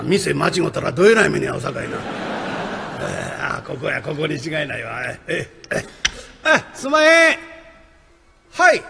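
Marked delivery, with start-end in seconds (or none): 6.4-8.8 calling at the door. Acted. Rakugo visitor calling into the house, loud and high. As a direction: voice pitched up and loud to call out.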